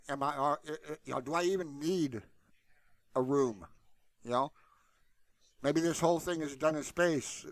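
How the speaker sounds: ordinary talk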